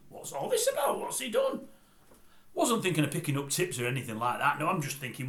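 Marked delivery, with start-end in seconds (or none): 0.1-1.7 squeaky high disbelief; high-pitched what's he done; mock surprise. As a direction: shoot the voice way up in shock. His own retold surprise.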